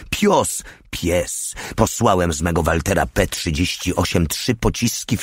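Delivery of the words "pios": sudden high-pitched yelp of a word; almost childish voice, pitched way up; childlike snarl. A narrator's reading. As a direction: snap it out high, like a youngster. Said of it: "Prawie dziecinny głos warknął".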